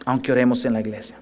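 low, soft echo of the phrase. Shown as a demonstration. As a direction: let it sink in quietly. (repetición baja y suave)

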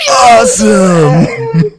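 slow, high, loud awesome; mock dopey awe; drawn-out awesome. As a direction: stretch awesome out slowly. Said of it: "And he's like".

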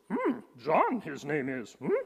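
hammed-up little sage voice with questioning hums; inverted phrasing in a put-on creature voice, humming either side; Yoda. Preacher jokingly retells the verse to the congregation, Yoda impression. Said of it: "better translated in the Yoda version".

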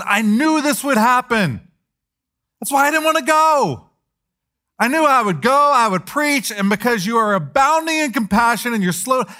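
high, loud, bitter complaint to God; whiny angry Jonah. Acted. Jonah's angry prayer voiced.

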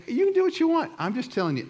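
high, loud retort from the wife; firm wife. Retold or imitated. Wife telling her husband to do what he wants, high and loud. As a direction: shoot it back as the fed-up wife. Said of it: "And she goes".

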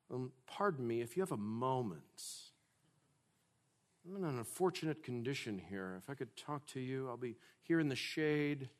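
soft, quick, timid request; over-courteous beggar, much too quiet to be heard; mock polite beggar. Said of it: "beggars don't make progress by being polite".

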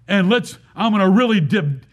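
normal talking voice

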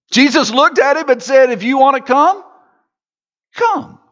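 a climbing call on come; Jesus calling Peter, voice soaring up. Retold or imitated. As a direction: call him out onto the water.

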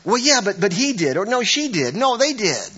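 high, whiny finger-pointing voice; defensive blaming. Retold or imitated. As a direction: protest like a caught spouse. Imitated blaming quarrel.

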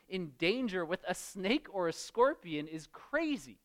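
ordinary talk

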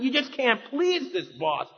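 ordinary talk

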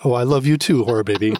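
put-on talking to a baby. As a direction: melt at your child's words. Father tender to toddler.

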